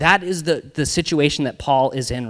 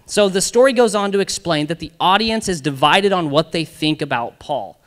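usual speaking voice

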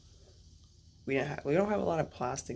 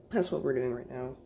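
ordinary talk

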